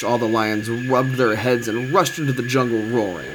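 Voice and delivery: plain reading voice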